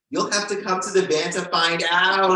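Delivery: loud, projected retold reply; ominous tease. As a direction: announce it like a creepy invitation. Retells his ominous teasing answer.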